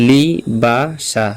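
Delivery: "লি বা সা" slowly, with a gap after each syllable; teacher carefully spacing out "লি বা সা" to follow along. Shown as a demonstration. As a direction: sound out "লি", "বা", "সা" one at a time. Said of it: "আমি ধীরস্থিরভাবে বা আস্তে আস্তে বলি".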